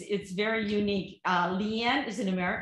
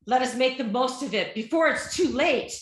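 stage actor's declaiming, pitched up; higher, punchy amateur stage delivery; theatrical recital; 4 semitones higher, 3 dB louder than usual. Acted. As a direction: recite it emphatically, like lines from a play. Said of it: "but this is theater also".

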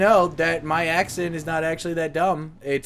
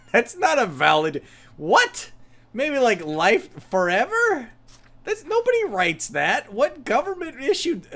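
incredulous; 5 semitones higher, 4 dB louder than usual. Real, not acted. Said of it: "incredulous at the form".

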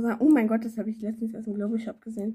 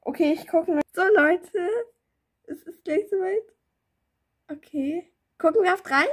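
excited; 7 semitones higher, 4 dB louder than usual. Real, not acted. Excited countdown.

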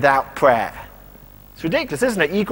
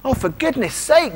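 higher-pitched mock exasperation; a bit exasperated; 7 semitones higher than usual. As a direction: sigh it out as a mild, higher-pitched reproach. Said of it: "almost a bit exasperated about them".

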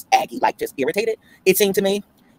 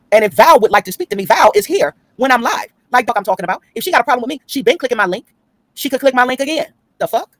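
higher, raised defiant rant; heated rant; 8 semitones higher, 4 dB louder than usual. Real, not acted. Daring Val to come to her live, raised and defiant. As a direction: call Val out with heat.

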